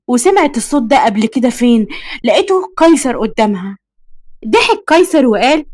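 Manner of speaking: ordinary delivery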